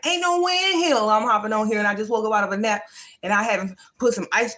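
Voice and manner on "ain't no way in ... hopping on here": higher-pitched, emphatic balking; lightly hammed-up protest; mock refusal. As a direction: imitate your past self flatly refusing, pitched up. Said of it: "I'd be like".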